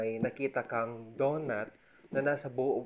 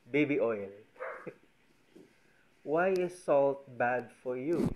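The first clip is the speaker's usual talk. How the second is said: ordinary talk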